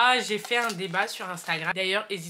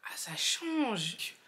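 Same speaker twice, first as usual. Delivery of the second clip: whisper; 6 dB quieter, much slower than usual. Real, not acted. Sniffing the perfume, whispered.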